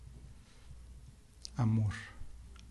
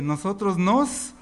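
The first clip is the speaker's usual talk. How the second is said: louder; 3 semitones higher, 5 dB louder, slower than usual. Shown as a demonstration. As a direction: say it louder, slowly, drawn out. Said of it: "No, más fuerte".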